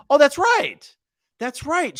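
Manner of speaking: high, excited oh that's right; sudden realization. Real, not acted. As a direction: realize it all at once.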